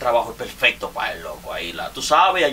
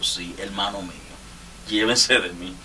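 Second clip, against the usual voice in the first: usual speaking voice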